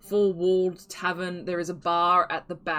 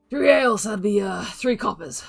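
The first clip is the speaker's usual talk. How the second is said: a louder, higher barkeep voice; gruff barkeep; 4 semitones higher, 8 dB louder than usual. Acted. Gruff bald barkeep quoting the price to the party, in a loud, higher voice. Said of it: "and he's like".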